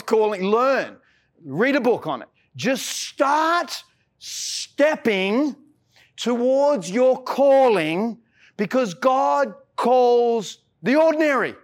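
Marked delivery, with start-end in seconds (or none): 2.5-11.6 drawn out very slowly, building to the end; slow emphatic preaching. Real, not acted. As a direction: stretch each word of "God calls the ordinary" out slowly. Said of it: "repeated key line, preached".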